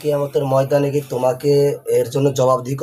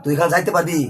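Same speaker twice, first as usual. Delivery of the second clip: raised, menacing voice; threatening; 6 semitones higher than usual. Real, not acted. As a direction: threaten the spirit forcefully. Exorcist threatens the jinn. (উঁচু ধমকের স্বরে)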